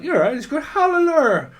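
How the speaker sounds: high, loud tripping-out voice; hammed-up acid-tripping man; lightly voiced stoner; mock tripping guy. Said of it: "He's like".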